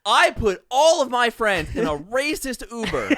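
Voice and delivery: high, loud clickbait announcer; slow, booming hype-video title read; MrBeast. Acted. YouTuber pitching a shocking video title, hyped-up impression. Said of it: "I'm gonna do my, my Mister Bean".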